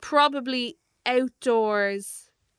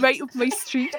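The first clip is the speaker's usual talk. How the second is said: put-on Geordie accent; 3 semitones higher than usual. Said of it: "That's gone a bit [name]".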